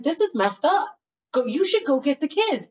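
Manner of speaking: high-pitched retold exasperation; indignant urging. Boyfriend urging her to get the kids, indignant urging.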